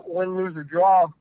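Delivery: usual speaking voice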